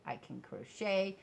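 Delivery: ordinary talk, nothing marked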